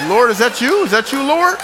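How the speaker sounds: high-pitched comic cry to the Lord; mock emotional awe. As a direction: call up to God, hammed-up and overcome. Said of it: "I was getting all emotional".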